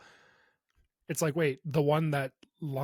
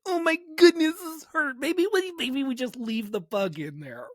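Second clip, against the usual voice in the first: very high, loud whimpering voice; whiny baby voice; 11 semitones higher, 5 dB louder than usual. Retold or imitated. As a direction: whine like a hurt baby. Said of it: "I'd be like".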